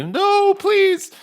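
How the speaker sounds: very high-pitched mewled plea; loud mewling. Acted. Game master voices the illusory gnome behind the worms, mewling loudly. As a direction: mewl it out in a squeaky falsetto. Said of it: "it mews loudly".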